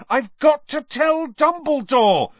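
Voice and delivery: high, loud, slow desperate yell; panicked boy yelling at a teacher. Acted. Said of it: "Harry yelled".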